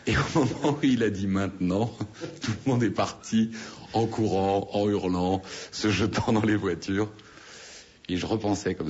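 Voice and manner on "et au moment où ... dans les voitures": higher-pitched, amused telling with laughter breaking in; laughing while telling. Real, not acted. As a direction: laugh your way through the punchline. Amused punchline about the panicked flight. (récit en riant, voix qui monte)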